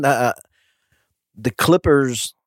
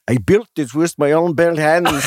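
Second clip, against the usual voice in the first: slow, badly faked Scottish accent; attempted Scottish accent; 3 semitones higher than usual. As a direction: tell the joke as a Scotsman. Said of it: "used to do a great Scottish accent".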